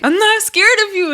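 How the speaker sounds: high-pitched mock defiance; imitated defiance. Krishan insisting to Tasiki, high mock defiance. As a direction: mimic a squeaky show of bravado. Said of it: "Krishan's like".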